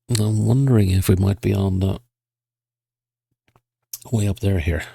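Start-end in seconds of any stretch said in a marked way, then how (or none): none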